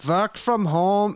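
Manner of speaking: deliberate Austrian strongman voice, one word at a time; mock Austrian bodybuilder accent, slow and loud; Schwarzenegger impression. Shown as a demonstration. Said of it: "you should do it in a Schwarzenegger voice".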